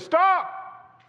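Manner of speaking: high, loud command; commanding shout. Retold or imitated. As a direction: bark it out like a warning from above. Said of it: "It says".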